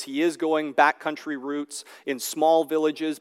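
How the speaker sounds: ordinary delivery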